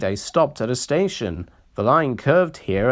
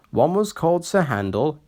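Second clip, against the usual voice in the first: ordinary delivery, nothing marked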